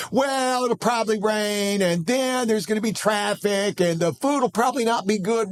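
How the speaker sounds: put-on high, whiny pessimistic kid voice; mock a gloomy little boy. Father mimicking his gloomy youngest son, high and whiny. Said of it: "my youngest son will do the same".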